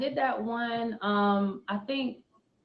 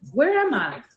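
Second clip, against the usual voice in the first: calling out; 4 semitones higher, 6 dB louder than usual. Acted. Poem, calling out.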